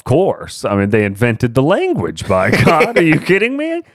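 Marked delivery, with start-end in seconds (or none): 2.3-3.8 mock outrage. Mock outraged Texan pride.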